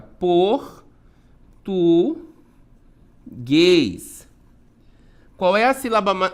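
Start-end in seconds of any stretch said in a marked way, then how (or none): 0.0-4.3 teacher splitting português into syllables, very slowly with long gaps; drawn-out syllables, a pause after each. Shown as a demonstration. Teacher showing the separação silábica, syllable by syllable. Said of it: "separação silábica".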